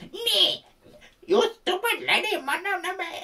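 very high, mocking, laughing insult voice; rude scammer. Pretend scammer yelling at an old lady in a high mocking voice. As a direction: squeal it out like a rude caller. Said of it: "I would be yapping about".

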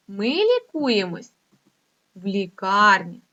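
slow, deliberate poem lines with each key word pushed; slow dictation. Shown as a demonstration. Teacher dictating corrected rhymes to pupils, slow. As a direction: dictate it for children to write.

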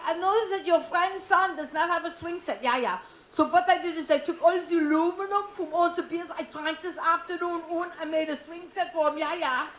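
industrious German boyfriend in a mock accent; thick comic German accent with eager explaining. German boyfriend explaining his beer-can swing set, mock German accent. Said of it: "'cause he was German".

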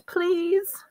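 high-pitched, desperate begging; thin, high, pleading cry; frantic plea. A narrator's reading. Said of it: "Hermione whispered frantically".

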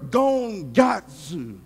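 gongatsu said slow, low, drawn out; slow careful gongatsu; Greek word gongatsu. Shown as a demonstration. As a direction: spell out gongatsu slowly. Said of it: "they use this Greek word".

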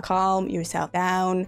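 drawn-out, slow calming words; slow self-soothing. Real, not acted. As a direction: talk yourself down slowly, word by word. Repeats it to steady herself.

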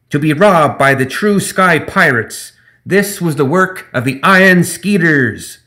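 high, loud, slow gremlin boast; pompous gremlin proclamation. Acted. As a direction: announce your glory to the crowd. Zip shouting while flying.

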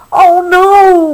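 high, loud storybook wail; dismayed cry. A narrator's reading. Billy finds the animals asleep, cries out in mock dismay. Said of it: "Billy cried".

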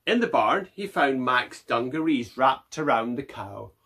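ordinary delivery

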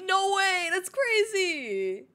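high, loud, drawn-out astonishment; mock amazement. Streamer playing amazed with chat, hammed-up surprise. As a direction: gush in hammed-up disbelief.

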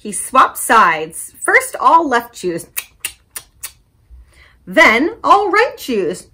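slow and loud, chewing each word; slow, chewy reading. Acted. Librarian acting Reuben's chewing, slow and loud. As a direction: draw out the chewing.

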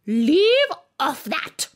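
high, loud, slow scream; screaming queen. A narrator's reading. Queen screaming at the bowing gardeners. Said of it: "screamed the Queen".

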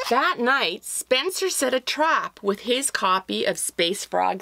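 lively read-aloud storytelling voice; story narration. A narrator's reading. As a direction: tell it like reading to children.

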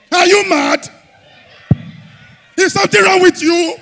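high, loud outraged shout; comic shout. Acted. Comedian yelling at the woman over baby teeth, loud and high. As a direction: yell it at her, pitch way up.

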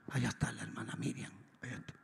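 low, very soft, trailing away; hushed aside. Retold or imitated. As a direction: spot someone and murmur it under your breath. Spotting her during the prayer.

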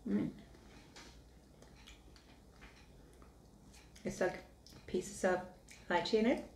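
talking while chewing; muffled by food in mouth; mouth full, chewing. Real, not acted. Seen 0: Spoon in mouth before; cheeks full, chewing while talking, next bite.

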